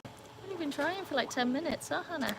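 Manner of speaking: high mocking whine to the dog; mock whiny. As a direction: pitch it up and whine it back.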